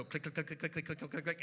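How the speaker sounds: rapid run of clicking syllables; imitated chain clicks. Window chains going up, rapid imitated clicking. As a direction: rattle off the clicks in an even rhythm.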